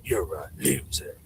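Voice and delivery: low whispered villain boast; mock whisper. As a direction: hiss it like a cartoon villain. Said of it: "that's my impression of Sanity Machine".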